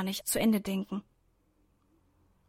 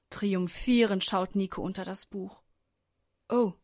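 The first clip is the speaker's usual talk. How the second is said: usual speaking voice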